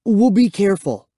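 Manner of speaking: high, eager assurance; promising. A narrator's reading. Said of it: "I promised".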